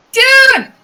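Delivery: high, loud, delighted call; excited shout. Acted. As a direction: yell it out in happy surprise. Spivot greets June loudly.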